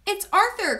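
high, delighted exclamation; excited cry. A narrator's reading. Henry spotting the butterfly, excited cry. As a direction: announce it with a child's excitement. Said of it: "cried Henry".

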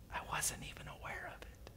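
hushed, voiceless whisper. Shown as a demonstration. Preacher repeating a verse about God's presence, whispered.

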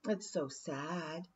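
soft, slow mock pity; mock sadness. A narrator's reading. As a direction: pretend to pity her. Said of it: "she continued".

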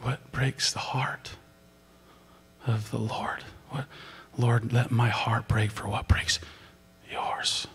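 soft whispered prayer. Real, not acted. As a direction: breathe the prayer to God.

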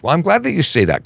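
ordinary delivery

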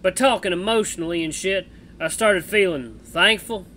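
put-on loud, drawling cowboy accent; Misha cowboy accent. Streamer voicing Misha the bat to Jenna, cowboy accent. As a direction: play the bat as a rough cowboy, American West accent. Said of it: "cowboy accent for Misha".

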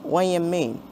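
ordinary talk

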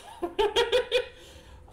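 squealing laugh, high and loud; high burst of laughter. Real, not acted. Laughing at her own therapy story, high squeal.

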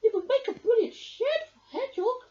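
high, eager little voice; excited. Acted. Mouse suggests a shed for Hedgehog, excited and high. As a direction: bounce with a new idea. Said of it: "Mouse hopped excitedly".

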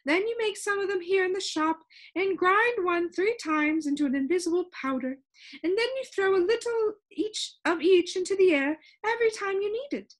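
high-pitched, lively storybook shopkeeper; high voice of a proud expert; Soundkeeper character. A narrator's reading. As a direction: explain it eagerly in a high character voice.

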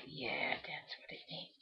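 half-whispered to herself, slow; breathy aside under the breath; whispering. Real, not acted. Mutters to herself while painting.

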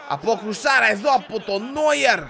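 high, loud call of the save; excited commentary. Real, not acted. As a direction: exclaim at the keeper's save.

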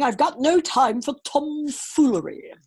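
loud, gruff royal growl; growling king. A narrator's reading. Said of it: "growled the king".

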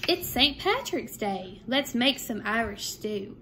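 lively sing-song picture book reading; bouncy storytime voice with a lifted refrain; reading to children. A narrator's reading. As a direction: read the rhymes aloud to a second grade class. Reads picture book to class.

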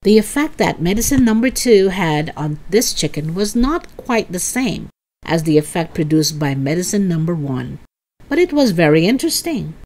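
plain reading voice

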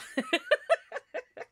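high burst of laughter; giggling high laugh. Real, not acted. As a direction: laugh at your own joke. Laughing at own mock voice.

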